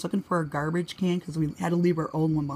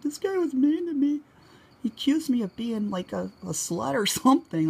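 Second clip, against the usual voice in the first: high-pitched, mildly hammed-up upset; retold tearful complaint; 7 semitones higher than usual. Crying teen telling a woman in the bathroom, retold upset. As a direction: complain as if you had been crying. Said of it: "I was crying".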